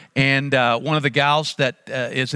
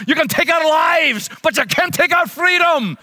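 high, loud Scottish battle cry; Scottish brogue with gusto; 12 semitones higher, 6 dB louder than usual. Retold or imitated. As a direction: bellow it like a Highland warrior. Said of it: "say it with gusto".

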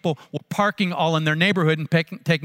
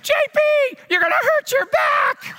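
hammed-up wife yelling a warning; wife shouting. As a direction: call out in alarm as the wife. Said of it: "sounded a lot like my wife".

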